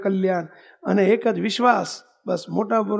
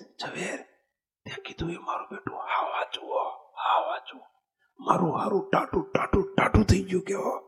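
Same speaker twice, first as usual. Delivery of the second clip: hushed whisper, secret aside; whispered in the ear; 8 dB quieter than usual. Retold or imitated. The darbar whispers his awe into Zaverbhai's ear. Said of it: "જોડે ઝવેરભાઈ કાનમાં કહે છે".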